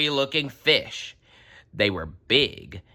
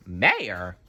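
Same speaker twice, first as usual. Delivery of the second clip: ordinary delivery, nothing marked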